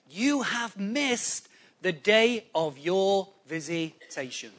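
slow, word-by-word retold lament. Preacher quotes Jesus crying over Jerusalem, slow solemn lament. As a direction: draw out each word, "vi-si-ta-tion" in pieces. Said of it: "he's crying and he says".

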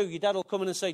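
ordinary talk

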